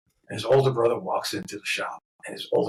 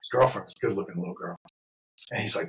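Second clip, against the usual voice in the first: usual speaking voice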